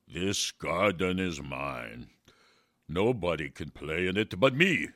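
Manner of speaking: loud, slow possessive giant; angry giant. Acted. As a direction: growl it like a selfish giant. Giant claims his garden.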